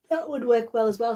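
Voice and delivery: talking through a yawn. Real, not acted. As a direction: say it mid-yawn. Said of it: "Sorry for yawning".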